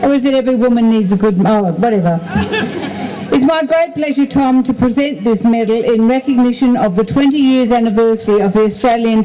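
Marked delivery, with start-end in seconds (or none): 2.2-3.3 laugh. Real, not acted. Mayor laughs at own joke.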